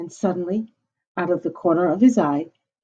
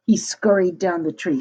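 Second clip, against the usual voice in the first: ordinary delivery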